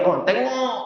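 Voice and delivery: high, drawn-out village holler; high-pitched mock calling out; mock yell. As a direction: imitate an aunt crying out to the whole village. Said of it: "dia teriak".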